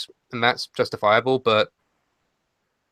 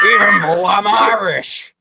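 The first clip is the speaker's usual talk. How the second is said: mock Irish; 7 semitones higher, 5 dB louder, slower than usual. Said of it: "I'm from Scotland, apparently".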